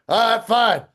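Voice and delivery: high, loud, grudging retreat; huffy yell. Retold or imitated. Drunk guitarist leaving when friend urges, still angrily yelling. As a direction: act out giving in while still fuming. Storming out after the quarrel.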